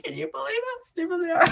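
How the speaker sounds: high-pitched mock gloating through fits of laughter; hysterical laughing. Imagined Russian operatives laughing at the people they fooled, hysterical laughing. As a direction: crack up so hard the words squeal out. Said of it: "go home at night laughing".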